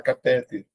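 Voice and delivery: "catéter" said as ca-TÉ-ter, middle syllable stressed. Shown as a demonstration. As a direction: lean on TÉ in "catéter". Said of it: "o pessoal costuma falar".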